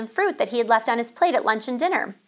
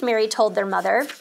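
usual speaking voice

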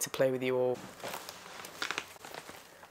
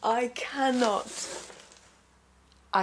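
overwhelmed; 10 semitones higher, much slower than usual. Real, not acted. Overwhelmed by the gift.

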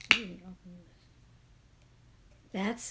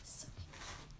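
soft unvoiced whisper; hushed whisper over the box; 12 dB quieter than usual. Real, not acted. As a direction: whisper it like a secret. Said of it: "I don't know why I'm whispering".

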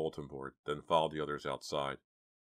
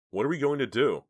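high, loud frightened question; panicked; 9 semitones higher, 10 dB louder than usual. Acted. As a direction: panic about what to do.

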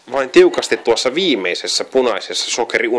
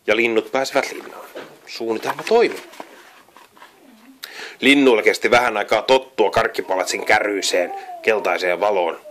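usual speaking voice

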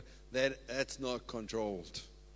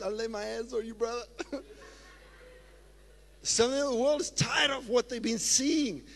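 ordinary talk, nothing marked

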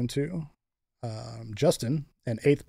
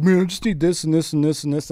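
lightly mocking, higher retold plea; slightly raised voice for a friend's needy running list; imitating Ryan; 4 semitones higher, 4 dB louder than usual. Host quotes Ryan fretting through game day, imitating him. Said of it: "he's just like".

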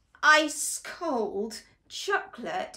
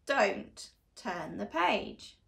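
ominous hush; 4 semitones lower, 5 dB quieter, slower than usual. Acted. Ominous warning.

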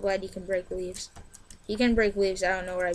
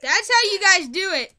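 high, loud victory crow; triumphant cheer; 9 semitones higher, 6 dB louder, slower than usual. Real, not acted. Player boasting to viewers after winning, high loud cheer. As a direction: crow over the win.

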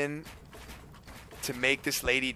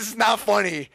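milder mock scolding, somewhat raised pitch; retold angry dad; 6 semitones higher, 4 dB louder than usual. Son re-quoting his mad dad, mild scolding. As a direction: repeat dad's telling-off with less force. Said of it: "he's getting mad that we think it's funny".

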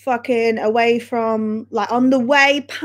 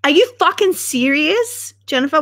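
higher, aggressive-sounding disbelief; 4 semitones higher than usual. Real, not acted. Streamer reacting in disbelief to Jennifer's comment, sounding aggressive. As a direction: challenge Jennifer, unintentionally aggressive. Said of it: "Why do I sound so aggressive?".

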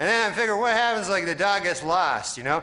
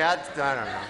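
ordinary talk, nothing marked

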